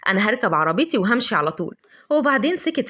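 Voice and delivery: ordinary delivery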